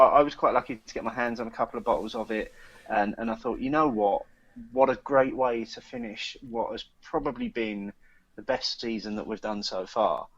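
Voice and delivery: ordinary talk, nothing marked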